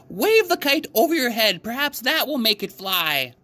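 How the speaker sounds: excited frog full of hope; bright, chirpy storybook Frog; Frog's voice. A narrator's reading.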